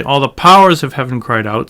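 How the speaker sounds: "powers" stressed as the main word. Shown as a demonstration. Teacher repeating what you don't say, stressing "powers". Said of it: "you don't say".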